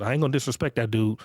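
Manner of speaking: ordinary talk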